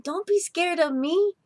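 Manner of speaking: high, coaxing cat voice; smooth cat trying to sound harmless; purring cat. Acted. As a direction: charm the mice out of hiding. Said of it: "Chato purred".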